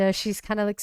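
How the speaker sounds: normal talking voice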